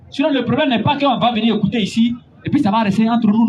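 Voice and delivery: usual speaking voice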